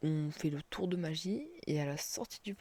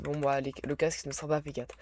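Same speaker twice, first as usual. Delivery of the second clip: usual speaking voice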